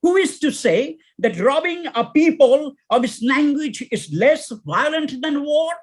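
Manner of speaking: high, impassioned poem declamation; brother's scream. Acted. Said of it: "screams our brother Smith".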